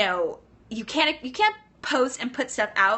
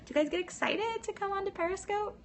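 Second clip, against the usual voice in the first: pitched way up, bubbling with excitement; excited; 11 semitones higher, 6 dB quieter than usual. Real, not acted. Host asking livestream viewers if they're excited, eagerly. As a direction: gush the question at the viewers. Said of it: "I get excited on here".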